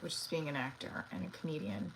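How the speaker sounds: usual speaking voice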